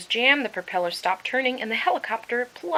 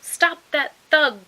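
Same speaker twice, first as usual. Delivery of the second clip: loud, slow outraged shout; cry out; 6 semitones higher, 9 dB louder, slower than usual. Acted. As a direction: shout for the thief to be stopped. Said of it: "cried the birds".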